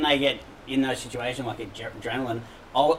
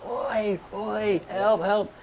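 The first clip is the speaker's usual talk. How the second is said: retold screaming; 8 semitones higher, much slower than usual. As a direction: imitate hollering for help, held back.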